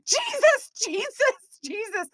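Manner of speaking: high panicked cries of Jesus, fading at the end; screaming Jesus. Retold or imitated. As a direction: cry out to Jesus in terror. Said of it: "I start screaming the name of Jesus".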